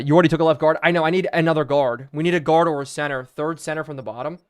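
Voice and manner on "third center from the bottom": ordinary talk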